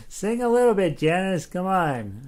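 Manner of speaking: high, loud playful pleading; coaxing. Real, not acted. Host urging a shy viewer to sing, loud playful coaxing. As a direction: urge them loudly, pitch jumping up.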